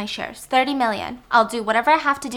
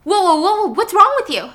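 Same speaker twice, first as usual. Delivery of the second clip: louder and higher, startled; alarmed shout; 9 semitones higher, 7 dB louder than usual. Acted. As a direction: back off a sudden threat. Helen startled by weapon.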